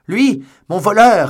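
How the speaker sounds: high, very loud, drawn-out cry; full-voiced, high yell of outrage; furious shout. A narrator's reading. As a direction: shout the accusation after a thief. Shouted reply while rushing out.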